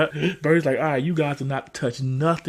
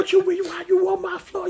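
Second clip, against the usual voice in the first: high-pitched exasperated uncle impression; mock scolding. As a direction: demand answers in a pitched-up mock rant. Acts out uncle scolding child.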